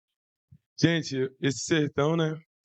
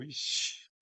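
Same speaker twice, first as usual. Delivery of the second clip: ixi sussurrado, quase sem voz; sussurro assustado; 3 semitones lower, 11 dB quieter than usual. Retold or imitated. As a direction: sussurre com receio do que vem. Medo ao ser chamado pela mãe. (sussurro de medo fingido)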